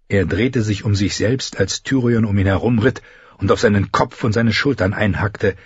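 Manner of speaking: ordinary delivery, nothing marked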